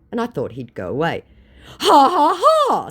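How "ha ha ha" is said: high, loud spelled-out "ha ha ha"; exaggerated fake laugh; mock scammer laugh. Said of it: "said my unrepentant scammer".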